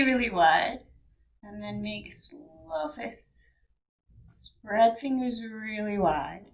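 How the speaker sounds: calm yoga instructor talking through movements; guided meditation. Acted. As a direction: guide the body through each stretch calmly.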